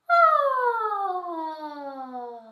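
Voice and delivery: long, high, loud sigh sliding down; big descending vocal sigh. Shown as a demonstration. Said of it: "sigh on ah".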